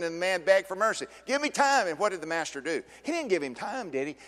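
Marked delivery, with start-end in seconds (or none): 1.2-1.9 high pleading cry; begging plea. Acted. Debtor to the king, high begging plea. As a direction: plead for time.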